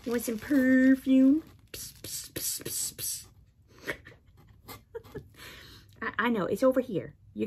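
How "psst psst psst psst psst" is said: soft unvoiced psst calls; calling hiss. Real, not acted. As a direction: hiss to get the cat's attention. Calling the cat.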